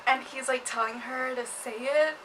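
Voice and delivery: normal talking voice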